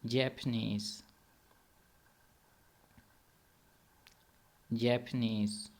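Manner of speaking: drawn-out جیپنیز with a long final ee; model pronunciation. Shown as a demonstration. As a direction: say جیپنیز slowly as "jap-a-neez". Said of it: "اس خاموشی میں آپ اس لفظ کو ادا کیا کریں".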